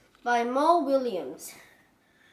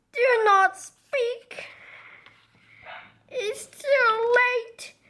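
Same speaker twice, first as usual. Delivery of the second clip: slow, higher, weepy; mock tragic; 8 semitones higher, 3 dB louder, much slower than usual. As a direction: drag out the doom. Gerald dramatic refusal.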